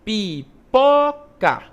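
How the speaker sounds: slow "pipoca", syllable by syllable, hitting PO hard; stressed syllable. Shown as a demonstration. Teacher showing pupils the strong syllable, syllable-by-syllable stress on "pipoca". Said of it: "vai ser pronunciada com maior força".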